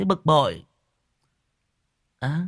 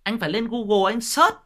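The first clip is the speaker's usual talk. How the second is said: rising scolding; 4 semitones higher than usual. Real, not acted. Lecturing the troll, rising heat.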